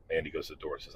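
usual speaking voice

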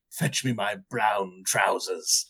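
low, slow captain's order; deadpan naval captain; grave captain. Retold or imitated. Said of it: "turned to his first mate and said".

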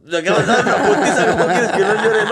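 high, loud protest broken by laughs; mock outrage, laughing. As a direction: kid protesting to his dad, laughing. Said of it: "Y yo".

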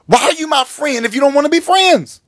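high, pleading outcry; a wounded plea, half shouted; mock hurt pastor crying out.

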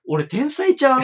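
loud, high self-praise; playfully boasting; excited. Real, not acted. Proud joke after guessing.

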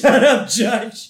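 quoting Homer yelling at the judge; shouted. Retold or imitated. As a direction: blurt it out at the judge. Repeating Homer's yell.

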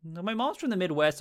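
ordinary talk

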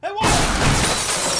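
shouting back. Acted. Cab driver yells back.

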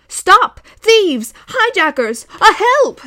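high, very loud panicked yells; shouting. A narrator's reading. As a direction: scream for help at the thieves. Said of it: "the balloonist was shouting".

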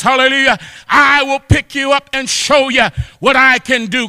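ordinary delivery

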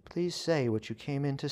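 put-on nervous young witch bracing herself; tense plea. A narrator's reading. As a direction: beg him to get it over with. Witch braces for bad news.

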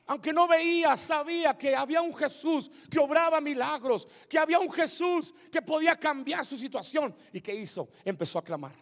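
ordinary talk, nothing marked

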